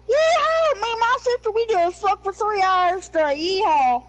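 high, drawn-out mock hillbilly holler; put-on hillbilly accent. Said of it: "let me change my accent here to match".

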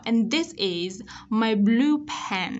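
slow, careful "And this is my blue pen"; teacher models s and z in "And this is my blue pen"; released endings. Shown as a demonstration. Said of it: "bật ending sound".

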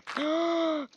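loud, high gasp; long, high, startled intake of breath; surprised gasp. Real, not acted. Sudden surprise in the game.

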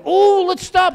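imitating a delighted wife; high, loud, gushing plea; excited squeal. Wife spots puppy for sale.

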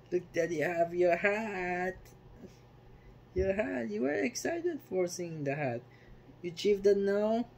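high playful pet-talk voice. Real, not acted. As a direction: coo at the pet about his hat.

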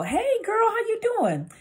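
very high-pitched, gushing greeting; sing-song high hello; bubbly friendly inflection. Shown as a demonstration. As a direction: greet a girlfriend in a squealy high voice. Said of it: "the inflection in your voice".

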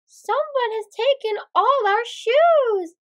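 high, dramatic storybook wail. A narrator's reading. Sister Sarah reports the stolen shoes, wailing. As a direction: lament it with swooping high pitch. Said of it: "she wailed".

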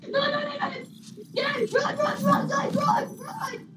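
high frantic panic; shrieking at everyone to run; terrified horror victim. Real, not acted. Screams at team to flee.

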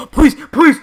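high, loud, desperate begging; frantic pleading. Real, not acted. Gamer begging the supply drop for Void, frantic pleading. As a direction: plead at full voice as the prize is revealed.

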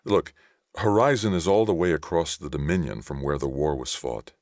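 emphatic protest, pitch jumping up on key words; acted exasperation in story dialogue; frustrated young man arguing. A narrator's reading. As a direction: argue your case with forceful stresses. Johnny argues with his brother.

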